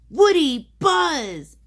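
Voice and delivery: high, loud, delighted exclamation; gasped in surprise. A narrator's reading. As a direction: cry out the names in astonishment. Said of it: "Andy gasped".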